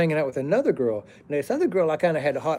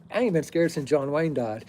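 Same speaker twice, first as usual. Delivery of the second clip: ordinary talk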